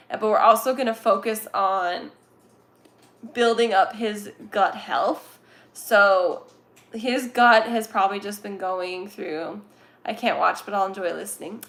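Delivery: ordinary delivery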